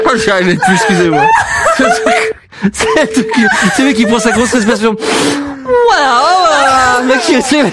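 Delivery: words squeezed out through helpless laughter; laughing hard. Real, not acted. Hosts after hanging up on the hotel, laughing hard.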